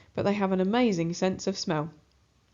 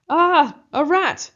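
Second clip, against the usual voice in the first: high, loud panicked shout; terrified yell; 9 semitones higher, 9 dB louder than usual. A narrator's reading. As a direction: scream it out, terrified of the rat. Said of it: "he yells, terrified".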